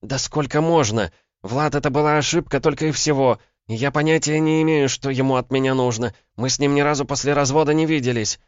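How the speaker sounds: high, loud, fed-up female voice; exasperated protest. A narrator's reading. Woman denying contact with her ex, exasperated. Woman heatedly denies affair.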